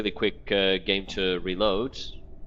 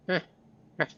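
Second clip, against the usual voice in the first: ordinary delivery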